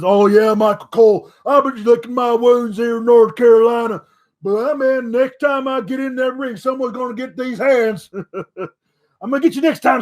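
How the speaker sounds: loose, boastful wrestler talk with a chuckle; tossing off a cocky promo, lightly voiced; pretend wrestler promo. Braun in a taped interview, mild cocky promo voice. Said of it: "where he says".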